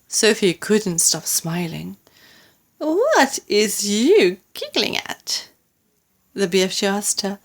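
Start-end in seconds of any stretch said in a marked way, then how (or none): 2.8-5.5 slow, higher, mildly put-out question; touch of indignation; slightly nettled. A narrator's reading. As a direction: ask it a little miffed, drawing the words out. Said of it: "slightly nettled".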